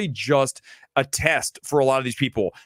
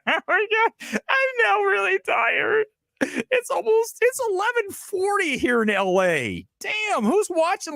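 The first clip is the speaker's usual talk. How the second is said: much higher, slow, put-on weary voice; mock tired whine; slower than usual. Streamer telling late viewers he's exhausted, high mock whine. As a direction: drag it out in a silly high sleepy whine. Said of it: "'cause I'm really tired".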